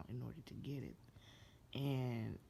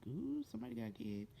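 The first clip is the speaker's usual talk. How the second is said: high, quick nosy "ooh"; playful scandalized discovery; gossipy surprise; 8 semitones higher, faster than usual. Retold or imitated. Viewer spots toys all over the walls, gossipy surprise. Retold reaction to spotting toys.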